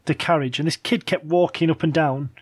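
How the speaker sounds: ordinary talk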